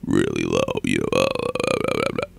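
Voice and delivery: exaggerated bottom-of-range rumble; extremely low voice. Shown as a demonstration. Vlogger imitating how low a character talks, very deep. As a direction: sink to a very deep croak. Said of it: "His voice is extremely low".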